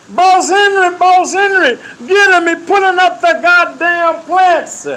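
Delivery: high, loud call for the guard. Retold or imitated. Captain yells for Boss Henry to take the worker, loud call. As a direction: yell it to get him hauled off.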